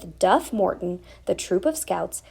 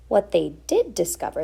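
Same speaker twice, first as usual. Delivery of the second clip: ordinary delivery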